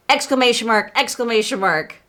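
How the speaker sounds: ordinary talk, nothing marked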